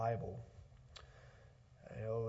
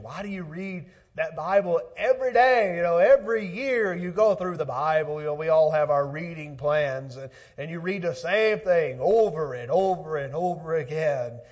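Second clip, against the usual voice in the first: louder, higher preacher's challenge; preaching; 5 semitones higher, 12 dB louder than usual. Acted. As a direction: voice the doubters' question from the pulpit. Preacher voices questioning people.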